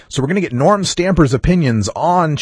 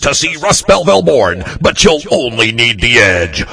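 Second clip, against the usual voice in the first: hammed-up event promo announcer, loud and booming; radio announcer voice; 4 dB louder than usual. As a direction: hype it like a big Sunday arena ad. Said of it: "Were you born with your radio voice?".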